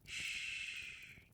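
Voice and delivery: long, very soft shush; soothing shush. Acted. Queen lulling her beloved to sleep, soothing shush. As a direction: hush them gently to sleep.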